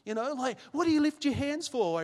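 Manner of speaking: put-on incredulous old self teasing his churchgoing mum; mocking sceptic. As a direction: scoff like the unbeliever he used to be. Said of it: "I'd be like".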